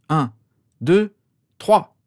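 high, loud count, word by word; starting countdown. A narrator's reading. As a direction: call the start of a race.

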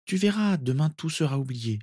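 put-on high, tender girl soothing a child; reassuring. A narrator's reading. Narcissa promising cousin all will be forgotten, reassuring. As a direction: comfort him in a high voice. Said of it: "le rassura Narcissa".

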